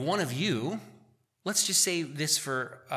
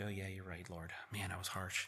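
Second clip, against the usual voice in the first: quiet admission; 6 semitones lower, 15 dB quieter than usual. Retold or imitated. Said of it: "I'm like".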